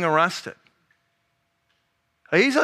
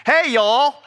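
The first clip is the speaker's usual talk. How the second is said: higher, put-on Southern greeting; Southern accent; 5 semitones higher, 4 dB louder than usual. Someone comes up to me after church, Southern accent. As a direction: greet folks in a Southern drawl. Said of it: "figured it out was from his accent".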